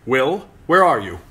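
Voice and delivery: loud call up a tree. Acted. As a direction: shout it up into the branches. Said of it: "Sir Waymar called up".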